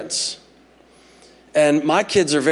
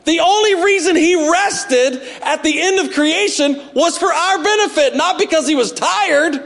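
preaching; 11 semitones higher than usual. Acted. Preaching at full force.

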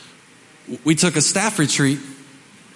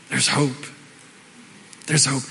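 soft reassuring; 4 semitones lower, 4 dB quieter than usual. Acted. Soft repeated message.

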